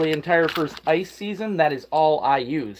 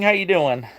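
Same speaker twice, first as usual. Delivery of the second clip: ordinary talk, nothing marked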